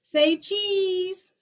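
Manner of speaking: high, piercing mock nasal call; nasal frog imitation. Imitating the eastern narrowmouth toad's call, high piercing and nasal. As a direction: squeeze it through the nose like the toad. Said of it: "it sounds to me like someone saying".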